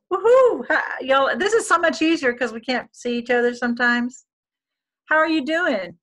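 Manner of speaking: loud, high excited welcome; excited greeting. Real, not acted. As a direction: greet the room with a whoop. Greeting new attendee.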